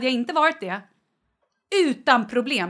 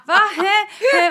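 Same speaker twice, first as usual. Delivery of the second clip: confused husband; 9 semitones higher, 9 dB louder than usual. Retold or imitated. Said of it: "Han bara".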